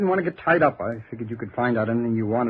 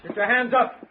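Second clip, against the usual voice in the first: sharp higher shouted command; sudden order; 8 semitones higher than usual. Acted. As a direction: bark it at the intruder in the dark. Gunman surprises detective in dark.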